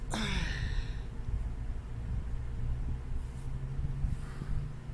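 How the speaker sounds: long, soft, tired exhale; weary angel sigh. Retold or imitated. Angels sighing at a stubborn believer, weary sigh. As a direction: let out a heavy disappointed sigh. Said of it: "the angels in them are like".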